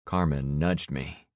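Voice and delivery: plain reading voice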